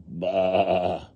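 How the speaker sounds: drawn-out "baa" at speaking pitch; mother sheep bleat. A narrator's reading. Mother sheep answering her lambs, unraised long bleat. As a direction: bleat like a grown sheep, not a lamb. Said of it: "Mother sheep saying".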